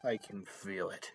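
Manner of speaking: low, hushed and ominous; hushed mystical. Acted. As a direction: sense it from afar. Dramatic mystical line.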